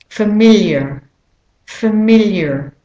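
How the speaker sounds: familiar with an American R sounded at the end; American pronunciation, familiar ending in "er"; American accent. Shown as a demonstration. Teacher modelling for learners, American accent on familiar. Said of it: "if you're saying it with an American accent".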